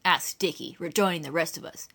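ordinary delivery, nothing marked

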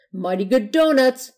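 high, loud, emphatic approval; hearty Grand Madam. A narrator's reading.

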